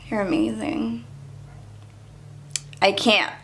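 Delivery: normal talking voice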